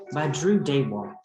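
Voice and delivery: ordinary delivery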